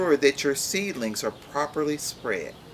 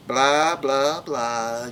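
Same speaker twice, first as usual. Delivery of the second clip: mocking blah; 3 dB louder, much slower than usual. Character mocks a threat.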